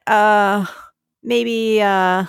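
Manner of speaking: ordinary talk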